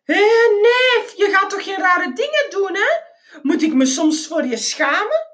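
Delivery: high, loud call that eases into scolding; loud yelling. A narrator's reading. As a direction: holler at your cousin, then chide him. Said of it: "gilde met luide stem".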